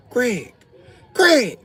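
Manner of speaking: high shrill calling. Retold or imitated. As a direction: call him from across the room. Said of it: "She was like".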